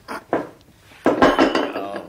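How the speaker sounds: usual speaking voice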